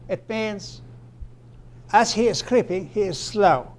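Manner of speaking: ordinary talk, nothing marked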